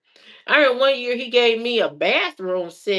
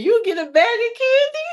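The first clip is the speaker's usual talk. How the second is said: very high, put-on voice of her dad, louder; mocking daddy voice; 5 dB louder than usual. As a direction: squeak it out as the cheap daddy offering candy.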